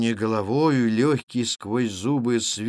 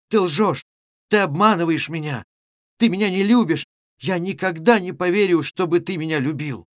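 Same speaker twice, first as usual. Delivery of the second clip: higher, louder, hurt protest; indignant accusation; 5 semitones higher, 4 dB louder than usual. A narrator's reading. Girl accusing the stranger of deceiving her, indignant. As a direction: throw each accusation at him, pitched up.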